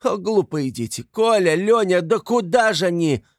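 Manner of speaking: high, loud alarmed calling; frightened cry. A narrator's reading. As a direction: cry out after runaway children. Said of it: "вскрикнула она вдруг в испуге". (испуганный крик высоким голосом)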